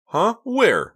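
surprised, talking with mouth full; chewing while asking. A narrator's reading.

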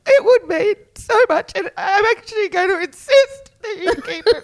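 teary, high voice breaking into a laugh; crying and laughing. Real, not acted. Friend retelling insisting on the ring gift, laughing through tears. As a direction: cry and laugh at once.

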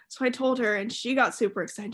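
ordinary delivery

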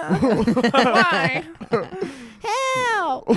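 tiny, very high squeal through laughter; moaning dying fly. Pretend. Playing a swatted fly for friends, high pitiful moan. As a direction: plead in a squeaky little insect voice. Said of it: "every time you killed a fly, it moaned".

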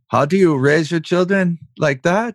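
put-on a half-laughing, clumsy German accent; mock German friend, lightly put on; amused attempt at a German accent; bad German accent. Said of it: "that was probably a horrible accent".

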